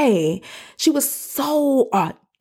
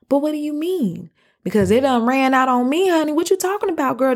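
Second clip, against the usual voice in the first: incredulous retort; 6 semitones higher than usual. Retold or imitated. Acts out her doubting retort.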